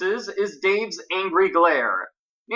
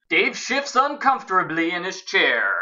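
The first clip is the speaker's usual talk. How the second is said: overdone dramatic narration; 3 semitones higher than usual. Real, not acted. Narrator builds up Dave's discomfort, in dramatic voice. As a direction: make a small moment sound momentous. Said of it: "Why am I doing that in a dramatic voice?".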